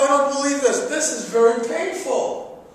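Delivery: high, loud comic complaint; re-enacted outraged outcry; pained protest. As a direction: wail in protest at the pain. Retells protesting during painful waxing.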